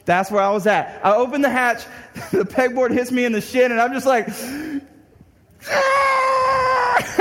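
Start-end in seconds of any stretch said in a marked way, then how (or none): 5.7-7.1 angry pained yell. Retold or imitated. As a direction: yell as the board hits your shin. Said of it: "I'm so angry".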